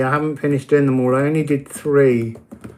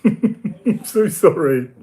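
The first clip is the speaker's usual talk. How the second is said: laughing; 3 semitones higher, slower than usual. Real, not acted. Laughing apology to leaving viewer.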